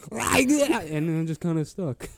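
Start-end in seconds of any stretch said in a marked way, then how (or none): 0.1-0.9 high, loud guttural growl burst; imitated strange noise. Friend mimicking how the actor found his voice, high loud growling noise. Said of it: "I just started going".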